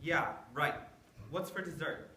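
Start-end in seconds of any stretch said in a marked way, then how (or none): 0.0-0.7 sarcastic. Acted. Stephen doubts grandpa.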